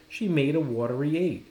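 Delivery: usual speaking voice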